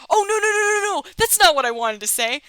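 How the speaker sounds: loud, high panicked no no no; real dismay. Real, not acted. Player yelling at the screen over a wrong choice. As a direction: blurt out horrified denial. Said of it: "That is not what I wanted to say".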